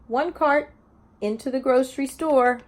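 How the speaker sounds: slow, lilting picture-book read-aloud; sing-song story reading to a class; reading to children. Acted. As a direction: read each page like story time for little ones.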